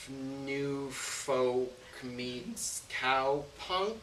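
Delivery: very slow, stretched-out words; halting, elongated genre label; drawn-out hesitation. Acted. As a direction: drag out each word as if inventing it. Hesitantly describing his own music style.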